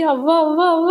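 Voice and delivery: stunned, sputtering the words out; shocked stammer. Acted. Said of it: "Keshava ఏమో shock అయ్యి". (Shock అయ్యి తడబడుతూ)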